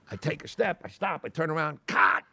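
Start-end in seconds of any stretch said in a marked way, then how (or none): 1.9-2.2 sharp loud cut; loud mock director's cut; yelled cut. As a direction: bark it like a director stopping the take. Retold director yelling cut.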